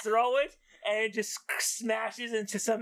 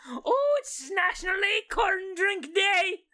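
high, loud squeaky party cheer; drunk squirrel voice; 11 semitones higher, 3 dB louder than usual. Pretend. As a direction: squeal a tipsy squirrel toast. Drunk squirrel toasting at bar.

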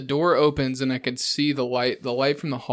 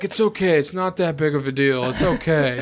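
put-on slightly louder, placating drunk friend; imitating drunk friend; 3 semitones higher, 4 dB louder than usual. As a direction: play a drunk pal brushing it off. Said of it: "this is what you were like".

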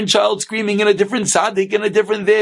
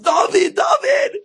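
very high mock scream; piercingly high acted shout; slower than usual. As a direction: call up to a window to wake someone. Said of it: "And he screams up".

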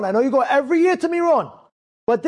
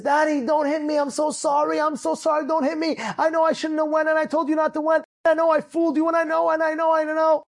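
breathless run of begging on one raised note; pleading apology; 3 semitones higher than usual. Retold or imitated. Son at the door begging his father's forgiveness, pleading. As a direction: beg and apologize without letting up.

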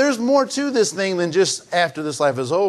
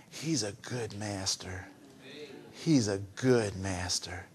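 much softer and lower, slow; hushed tender; 11 semitones lower, 14 dB quieter, slower than usual. Real, not acted. Preacher assuring the congregation of the good master, hushed and tender. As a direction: lean in and say it gently.

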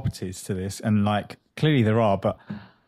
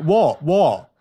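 loud, higher exasperated what; 5 semitones higher, 5 dB louder than usual. Retold or imitated. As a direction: ask the cat in exasperation. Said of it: "and I'm just like".

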